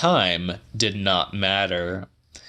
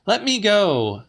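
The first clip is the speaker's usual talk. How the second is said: voiced much higher as the woman; Celia's voice; 12 semitones higher than usual. A narrator's reading. Woman held by the wrists, asking to be freed, higher voice. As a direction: plead to be let go, pitched up.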